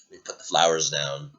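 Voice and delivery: usual speaking voice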